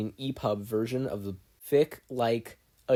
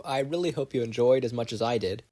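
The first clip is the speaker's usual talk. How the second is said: usual speaking voice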